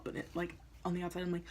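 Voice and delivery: ordinary talk, nothing marked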